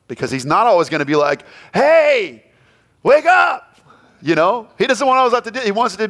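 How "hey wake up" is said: high, loud jolting shout; loud wake-up call. Retold or imitated. Preacher voicing God as a jarring wake-up shout. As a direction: yell it like a startling alarm.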